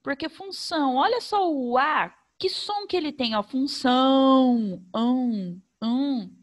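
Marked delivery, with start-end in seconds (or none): 3.3-4.8 função read slowly, leaning on the nasal ão; nasal sound shown. Shown as a demonstration. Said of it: "Parece que sai pelo nariz". 4.9-6.3 ão said alone, twice, as a held nasal vowel; nasal vowel demo. Shown as a demonstration. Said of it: "Parece que sai pelo nariz".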